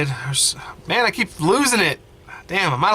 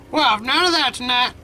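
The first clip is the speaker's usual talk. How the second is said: put-on a frail old grandmother, tender and teary; grandma voice; 10 semitones higher than usual. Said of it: "I hate that voice for her".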